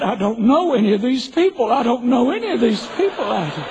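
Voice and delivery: high, loud tearful wail; mock crying wife. Wife crying to husband over cemetery plots, high mock wail. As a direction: play up a wife's weepy protest, loud and high. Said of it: "Just broke out crying".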